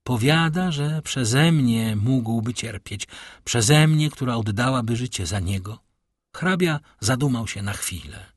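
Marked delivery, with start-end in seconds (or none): none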